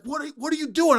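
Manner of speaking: high, irritated demand; exasperated coach. Retold or imitated. Assistant demanding why the walk-on ran out, high irritated. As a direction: snap the question at him in disbelief. Coach confronting player mid-practice.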